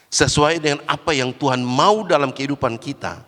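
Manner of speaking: usual speaking voice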